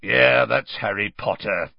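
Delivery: a gruff growl from behind. A narrator's reading. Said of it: "said a growling voice".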